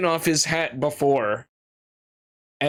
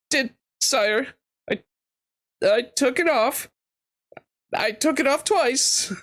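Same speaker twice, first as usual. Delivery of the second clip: frightened stammer; 8 semitones higher than usual. Acted. Frightened boy answering the king.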